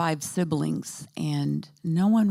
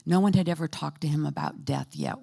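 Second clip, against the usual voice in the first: ordinary talk, nothing marked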